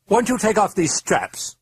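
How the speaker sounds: high wheedling British voice; wheedling clipped British. A narrator's reading. Demon wheedling the priest to untie it, clipped British accent. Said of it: "a wheedling voice in a clipped British accent".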